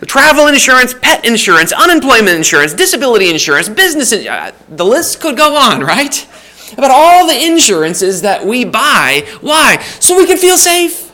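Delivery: escalating list ending on a high "feel safe"; building preacher's list. Acted. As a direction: pile up the list toward the peak. Building insurance list, preaching climax.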